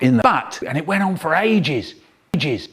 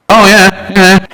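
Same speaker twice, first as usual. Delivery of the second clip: loud exclamation; 5 semitones higher, 14 dB louder, slower than usual. Acted.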